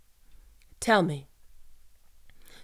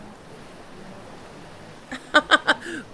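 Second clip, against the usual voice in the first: sinister laugh; 8 semitones higher than usual. Acted. Villain gloats over captive.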